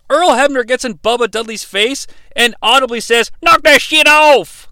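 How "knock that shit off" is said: loud, high, drawn-out southern yell; imitated southern-accented shout. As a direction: bellow it in an exaggerated southern accent. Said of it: "he's got that southern accent".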